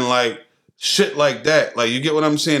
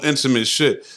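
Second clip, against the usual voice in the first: ordinary talk, nothing marked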